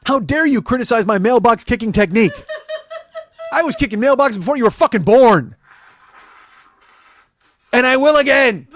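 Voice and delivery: loud, high, indignant blustering; hammed-up fury, building to a yell; mock outrage. Mailbox kicker defending his technique to Uncle Tito, mock outrage.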